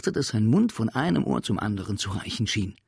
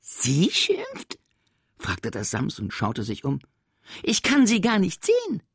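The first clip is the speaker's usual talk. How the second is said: playful creature; 11 semitones higher, 6 dB louder than usual. Acted. Sams misunderstands playfully.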